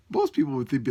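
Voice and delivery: ordinary talk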